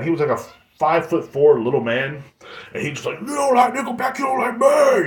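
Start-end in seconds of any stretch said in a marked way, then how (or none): 3.2-5.0 very high, strained comic yell; mock screaming. Little muscular friend defending Nickelback, high mock scream. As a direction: mimic a puffed-up little man shouting. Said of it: "he would scream that".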